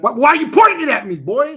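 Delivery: high, loud rapid shouting; retold fast angry shout; fast furious yell. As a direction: rush it out in a rage.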